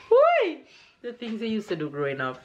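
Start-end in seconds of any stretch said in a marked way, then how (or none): 0.1-0.6 high, loud playful squeal; gleeful whee. Real, not acted. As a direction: let out a happy whee. Playful exclamation.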